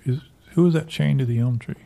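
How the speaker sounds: usual speaking voice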